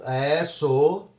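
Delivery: low, drawn-out reluctant reply; sheepish admission. Acted. Pastor admits his role to a questioner, sheepish admission.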